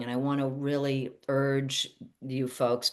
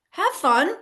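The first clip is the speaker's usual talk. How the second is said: high and loud, bright and teasing; playful high burst; 13 semitones higher, 7 dB louder than usual. Real, not acted. Coach urging listeners to play, a high squealing burst. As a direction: toss it off with a squeal of fun.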